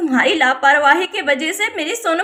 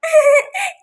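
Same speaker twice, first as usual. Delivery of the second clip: short high-pitched giggle; 10 semitones higher, 4 dB louder than usual. Acted. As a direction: giggle like a naughty child. Sonam giggles at the scheme. (शरारती बच्चे की ऊँची खिलखिलाहट)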